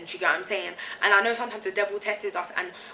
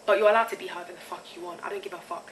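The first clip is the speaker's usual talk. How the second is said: usual speaking voice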